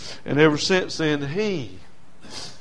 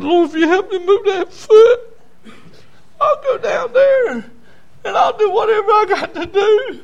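very high, loud pleading cry to God; re-enacted anguished bargaining prayer; desperate prayer; 7 dB louder than usual. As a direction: beg the Lord with a straining voice. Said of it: "First real prayer I prayed".

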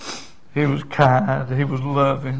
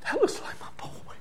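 hushed whisper of dawning recognition; acted breathless, tearful whisper; tearful father; 12 dB quieter than usual. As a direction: whisper it, hardly daring to believe. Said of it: "I can see him now crying".

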